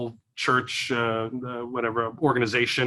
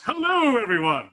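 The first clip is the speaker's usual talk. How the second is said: high-pitched hello, dropping back down; game show host God; 8 semitones higher than usual. Shown as a demonstration. Comedian demonstrating a God voice, high game-show greeting. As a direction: sing out a hammed-up host's greeting. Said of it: "that's my God voice".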